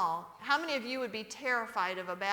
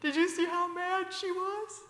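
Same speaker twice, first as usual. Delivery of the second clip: higher, chuckling conspiratorial dad; gleeful father; 6 semitones higher than usual. Retold or imitated. Father whispering to kids in back seat, gleeful tease. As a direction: gloat to the kids in a raised voice. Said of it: "My dad would go".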